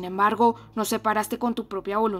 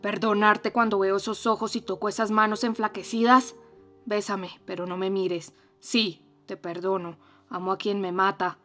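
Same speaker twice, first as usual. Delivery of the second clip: louder, torn man's voice; anguished reproach; 7 dB louder than usual. Acted. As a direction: forgive her while raging. Heathcliff answers Catherine.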